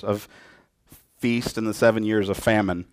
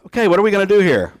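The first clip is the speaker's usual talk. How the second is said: higher, louder and fast, practical planner; eager Joseph; 7 semitones higher, 4 dB louder than usual. Retold or imitated. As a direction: rush into the plan, pitched up. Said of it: "Joseph says".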